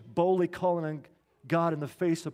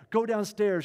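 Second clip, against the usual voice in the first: sudden, louder and higher blurt; 4 semitones higher, 5 dB louder than usual. Retold or imitated. Wife bursting in on her shaving husband, blurting urgently. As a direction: blurt it at him, eyes wide. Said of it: "she blurted".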